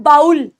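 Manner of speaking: बाउल with an "ow" glide, as in owl; common mispronunciation. Shown as a demonstration. Teacher showing how many people say the word, "ow" in बाउल. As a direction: say बाउल with "baa" sliding into "ul". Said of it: "कई लोग इस word को pronounce करते हैं".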